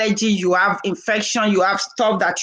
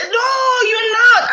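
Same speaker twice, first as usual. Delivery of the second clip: much higher, loud protest of disbelief; disbelieving cry; 13 semitones higher, 4 dB louder, much slower than usual. Retold or imitated. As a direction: squeal it out like you can't believe it. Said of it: "He said".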